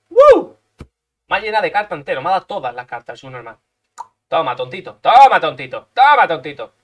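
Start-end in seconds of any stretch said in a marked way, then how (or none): 0.1-0.6 excited whoop. Real, not acted. Big play, celebrating. 4.3-6.7 loud taunt. Pretend. Taunting the enemy after a hit.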